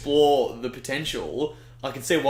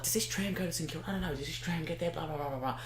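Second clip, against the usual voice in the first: put-on higher, much softer old ladies chattering; muttering old ladies; 4 semitones higher, 11 dB quieter than usual. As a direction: mimic old ladies fussing quietly. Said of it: "they're like".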